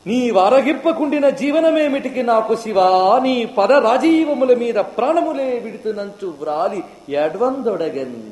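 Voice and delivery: high-pitched, drawn-out padyam chant; devotional verse recitation. Acted. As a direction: sing out the verse, stretching the endings. (రాగయుక్తంగా పద్యం)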